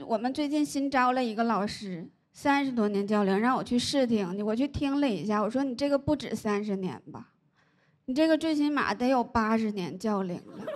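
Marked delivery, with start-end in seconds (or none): none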